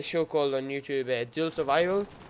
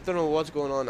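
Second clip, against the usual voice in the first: normal talking voice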